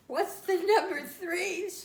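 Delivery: high, loud, slow puzzled ask; surprised question. Retold or imitated. Mother asks toddler what that means, high and surprised. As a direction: ask in disbelief.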